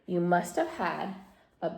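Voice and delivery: soft, slow, low comfort; gently calm a frightened child; soothing grandma. A narrator's reading.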